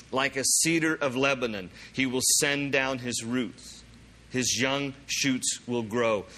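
measured, unhurried reading with pauses; slow scripture reading. Real, not acted. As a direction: read the verses slowly, letting each phrase land. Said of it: "I'm gonna read this slowly".

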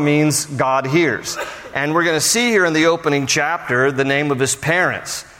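ordinary delivery

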